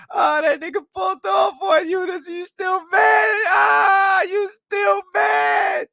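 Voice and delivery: very high, loud cackling yell, slowing on mad; mocking shout. Streamer jeers at the angry woman, high gleeful yell. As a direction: scream the taunt, gleeful and slow.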